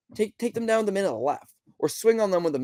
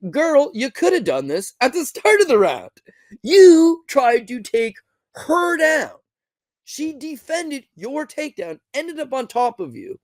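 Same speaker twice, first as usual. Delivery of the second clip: sassy scolding; 9 semitones higher, 4 dB louder, slower than usual. Pretend. Mocking the fighter directly.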